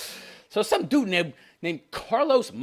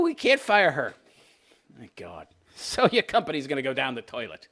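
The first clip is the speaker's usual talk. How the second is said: ordinary talk, nothing marked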